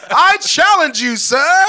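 loud, high, drawn-out challenger; mock duel challenge. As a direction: declare a duel with hammed-up grandeur. Mock duel challenge as debating persona.

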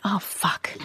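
hushed, breathy little curse under the breath; mimicked whispered swear; imitating daughter's whispered curse. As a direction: whisper it, as a small child would let slip. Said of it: "like that".

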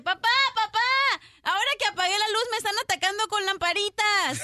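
put-on high, loud childish shout; panicked kid yell. Boy yelling to his dad at the punchline, loud high kid voice. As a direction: yell it like a scared kid in the dark.

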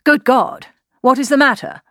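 startled, heartfelt cry; alarmed exclamation. A narrator's reading. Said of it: "with more feeling than politeness".

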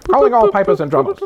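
announcer voice. Acted. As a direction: call the band together like a herald. Mock band announcement, repeated.

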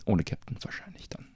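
breathy, very soft aside; whisper. Real, not acted. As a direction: whisper it to yourself.

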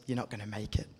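a quiet hint of a taunting lawyer. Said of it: "that little lawyer that tells us".

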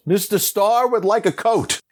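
high mock yell calling out; calls out to the crew on his behalf. Director yells to the crew for a coat, high call. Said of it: "And then he yells".

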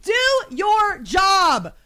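slow, high shouted demand; drawn-out furious command; raging out. Pretend. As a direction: hammer out each word in outrage. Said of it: "raging out in my mind".

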